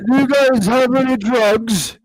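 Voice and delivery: high, slow stage call to a crowd. Retold or imitated. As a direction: draw it out high, addressing the audience. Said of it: "got on the microphone and was like".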